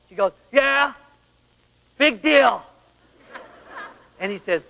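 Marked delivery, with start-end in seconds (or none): 0.5-2.6 higher, louder sarcastic big deal; scornful wife. Retold or imitated. Wife mocking husband's greeting, high and sarcastic. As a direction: sneer it at him.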